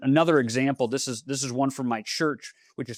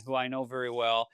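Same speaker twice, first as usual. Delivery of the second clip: normal talking voice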